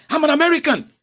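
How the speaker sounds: very high, badly faked American accent; fake American accent. Airport traveller claiming to be American, fake American accent. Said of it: "I'm trying to sound like an American".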